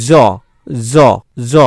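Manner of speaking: "জ" said as a buzzing z, not j; half-voiced jo. Shown as a demonstration. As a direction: separate demonstrations of "জ" as zo. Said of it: "অর্ধস্বর উচ্চারণ হয়".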